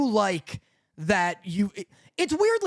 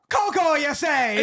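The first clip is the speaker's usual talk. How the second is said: slow, higher mock Scottish line; Scottish wand store guy; 6 semitones higher, 3 dB louder than usual. Quoting the anime's wand store guy, slow mock Scottish. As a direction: drawl it like the wand shop keeper, Scottish. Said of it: "There's one bit where he goes".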